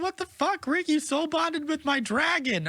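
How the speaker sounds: very high-pitched mock outrage; outraged kid character. Boy confronting Rick over his dragon, outraged.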